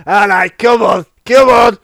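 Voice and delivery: loud mock Scouse, calling out; hammed-up Scouse hustle; Scouse accent. Stripper hurrying a customer in, loud Scouse.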